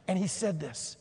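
ordinary talk

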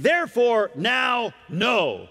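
each word punched out, now shouted high; verse barked out word by word; emphatic no. Shown as a demonstration. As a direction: hammer therefore, now, no one by one. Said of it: "the Greek word here is an emphatic no".

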